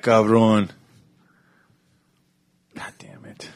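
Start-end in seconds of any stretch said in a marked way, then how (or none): 2.8-3.5 whispered curse. Real, not acted. After viewer picks love.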